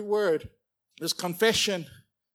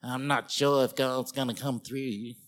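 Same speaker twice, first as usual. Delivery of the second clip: doubtful naysayer; 6 semitones lower, 3 dB quieter than usual. Retold or imitated. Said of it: "That's the way they talk".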